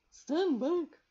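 high, loud sudden warning; warning shout. Acted. Carbonel warning Rosemary from the flame, loud. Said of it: "warned Carbonel".

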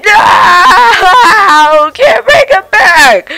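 crying out. Acted. Friend snapped away, grieving.

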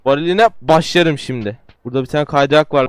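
ordinary delivery

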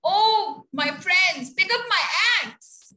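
a dead man shouting for his axe. A narrator's reading. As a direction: call out to friends from the stretcher. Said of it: "Woodcutter shouted".

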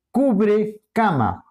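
cubre cama slowly, as two separate words; split into two words. Shown as a demonstration. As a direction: pull cubre and cama apart, each clearly said. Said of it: "Cubre pierde, cama conserva". (cubre cama lento, separando las dos palabras)

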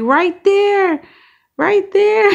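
high mock yell of an excited viewer; imitating a yell. Imitating Crystal yelling at the screen, high mock yell. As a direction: shout it like a fan pointing at the ticket. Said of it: "Crystal probably yelling like".

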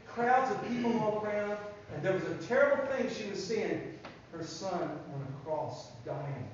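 story narration. Acted. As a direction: draw the children into the sad scene. Teacher reading Bible story to children.

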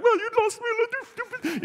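put-on very high, squeaky child's blubbering; mock crying. Lost boy to his dad, exaggerated tearful wail. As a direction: wail it like a bawling little boy. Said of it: "the boy was still crying".

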